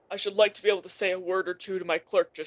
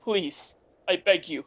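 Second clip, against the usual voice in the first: usual speaking voice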